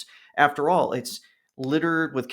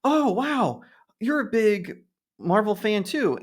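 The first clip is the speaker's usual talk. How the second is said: high, eager waiter voice, lightly done; lightly voiced excited waiter; imitating the waiter; 9 semitones higher than usual. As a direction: chirp it up like a friendly waiter. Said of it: "he says".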